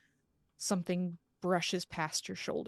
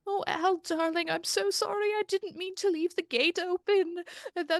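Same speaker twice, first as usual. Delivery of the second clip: bawling; 11 semitones higher than usual. Acted. Penelope apologizes to her dead dog, bawling and pitched high. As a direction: sob it out, much higher, begging forgiveness. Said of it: "She's just bawling".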